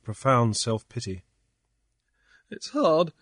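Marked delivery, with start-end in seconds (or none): 2.5-3.1 sobbing. A narrator's reading. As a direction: break into self-pitying sobs. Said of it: "he sobbed".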